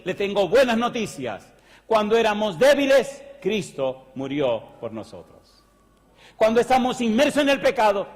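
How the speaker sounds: fiery sermon climax; preaching at full force. Acted. Preaching crescendo, refrain.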